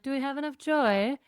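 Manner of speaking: light mock British accent. Imagining the couple checking their calendar, mock British accent. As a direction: playfully put on a posh British voice to ask it. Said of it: "in a British accent being like".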